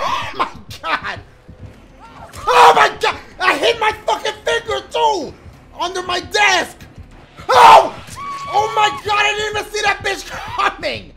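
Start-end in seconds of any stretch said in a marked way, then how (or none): 2.5-6.8 high, loud shocked complaint; rattled shouting. Real, not acted. Player hurt finger when startled, rattled shouting. 7.5-11.1 high scream into shouted disbelief; shriek, then exclaim in shock; startled shriek. Real, not acted. Player never saw her coming, startled shriek. Jump scare in game.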